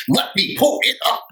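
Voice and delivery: high, sassy catchphrase; heated sass. Retold or imitated. Imitating the heated reunion guest. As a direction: snap it out with attitude. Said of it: "She was so heated".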